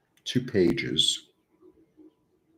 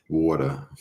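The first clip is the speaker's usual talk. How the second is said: "Water" in a New York accent; 2 semitones lower than usual. Shown as a demonstration. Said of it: "with that New York accent".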